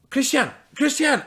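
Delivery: high, loud calls to rouse someone; urgent calling. Acted. Dubois shaking the sleeping farmer awake, urgent calling. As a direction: call the name out sharply, twice. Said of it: "he starts shaking him".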